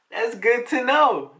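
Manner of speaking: high, loud, drawn-out mock glee; hammed-up delighted hero; gleeful. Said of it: "he's kinda just laughing".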